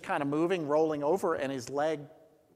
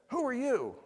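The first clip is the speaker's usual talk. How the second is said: high, sudden startled "Who"; startled question; 12 semitones higher than usual. Retold or imitated. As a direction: jump up in pitch on "Who". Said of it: "He might have said".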